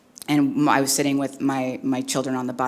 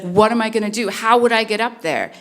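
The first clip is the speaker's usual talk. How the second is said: higher, louder imitation of a distressed kid; upset crying child; 7 semitones higher, 6 dB louder than usual. Crying son asking mom how he'd escape, imitated upset. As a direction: plead like a child close to tears. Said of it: "he's crying, like, he's very upset".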